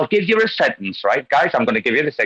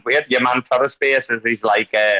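ordinary talk

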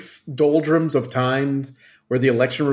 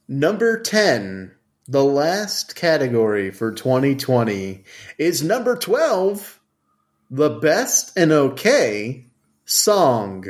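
slow announcer. Acted. Drawn-out category announcement.

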